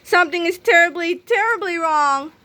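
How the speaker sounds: put-on a frightened boy wailing; panicked cry. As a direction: drag out terribly in dread. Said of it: "cried Harold".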